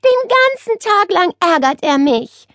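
high, aggrieved moan; whining. A narrator's reading. Nele complaining about being pestered all day, whining. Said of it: "jammerte Nele weiter".

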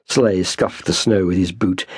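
plain reading voice

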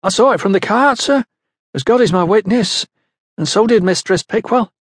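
high-pitched, earnest insistence; high young woman's protest to her boss; insistent protest. A narrator's reading. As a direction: swear to it in a high voice. Young woman insists to doubting foreman.

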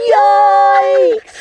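loud high shriek; startled yikes. Acted. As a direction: yelp in fright. Girl startled.

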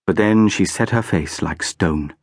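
plain reading voice

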